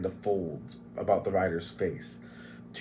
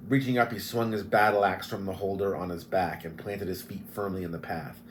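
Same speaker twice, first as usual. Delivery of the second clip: plain reading voice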